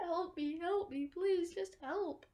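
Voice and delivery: high-pitched mock plea; pleading. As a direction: imitate desperate begging, slowing on the last words. Retold pleading for help.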